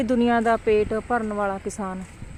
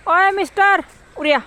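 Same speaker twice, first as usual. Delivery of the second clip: loud high call; 7 semitones higher, 4 dB louder than usual. Real, not acted. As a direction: call the dog over from afar. Calling out to a dog.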